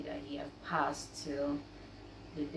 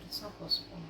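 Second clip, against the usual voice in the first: breathy whisper, stunned, repeating; a hushed whisper of shock; whispered disbelief; 10 dB quieter, faster than usual. Retold or imitated. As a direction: whisper it like you can't accept it.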